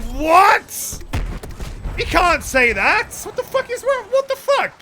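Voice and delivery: high-pitched, incredulous outburst; scandalized, voice shooting up; shocked protest. Retold or imitated. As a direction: re-enact appalled disbelief, climbing into a squeak. Acting out his shocked reaction.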